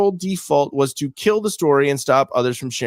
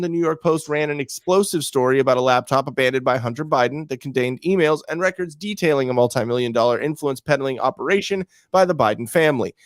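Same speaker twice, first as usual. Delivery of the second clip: usual speaking voice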